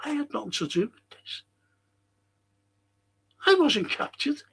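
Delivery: high, pleading voice of an accused plotter; mock denial. Plotter on the witness box denying it, in a mock high voice. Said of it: "On the witness box, saying".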